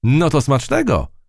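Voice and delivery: high cheery shout; calling out. A narrator's reading. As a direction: wish him a good meal from afar. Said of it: "krzyknęła chrobotka".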